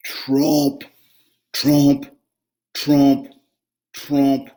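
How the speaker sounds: each tromp punched loud and slow; loud measured tromps; heavy tromping. A narrator's reading. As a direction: stomp out the hoofbeats. Hoofbeats on the bridge.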